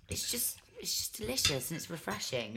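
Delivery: breathless whisper of delight; whispered relish. Real, not acted. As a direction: savour it in a whisper. Relishing prawn cocktail.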